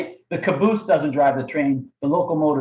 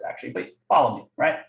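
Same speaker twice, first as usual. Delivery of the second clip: ordinary delivery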